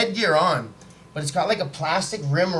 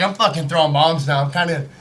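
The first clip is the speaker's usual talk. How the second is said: usual speaking voice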